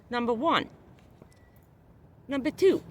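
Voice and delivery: ordinary delivery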